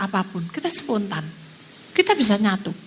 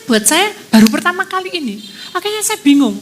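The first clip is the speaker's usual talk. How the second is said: ordinary talk, nothing marked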